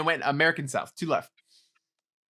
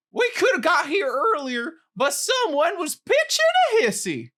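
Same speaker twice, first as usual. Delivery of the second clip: put-on Southern accent; 8 semitones higher than usual. Host finally lands the phrase in a Southern accent. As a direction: push a big Southern accent, rising in pitch. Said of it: "Pretty good. I got there eventually".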